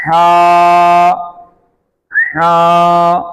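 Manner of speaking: loud, drawn-out recited letter; Quranic recitation. Shown as a demonstration. Teacher demonstrating correct letter recitation, recited. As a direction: intone it like a reciter. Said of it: "ये देखें".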